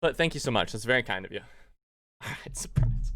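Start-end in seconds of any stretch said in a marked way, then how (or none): 1.8-3.1 breathy whispered aside through a laugh. Real, not acted. Streamer thanking a viewer on the side, laughing whisper. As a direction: whisper it with a chuckle.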